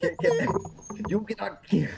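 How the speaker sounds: high put-on Japanese accent; mock Japanese dentist. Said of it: "in terms of the Japanese dentist".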